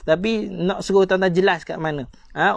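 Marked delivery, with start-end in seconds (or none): none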